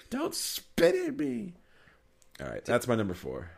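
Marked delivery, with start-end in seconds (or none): 0.1-1.5 much higher, slow and prim; prissy owner. Retold or imitated. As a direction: draw it out primly. Quoting owner.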